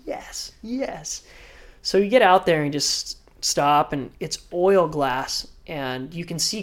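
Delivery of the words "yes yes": quiet excited yes. Retold or imitated. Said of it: "I'm like".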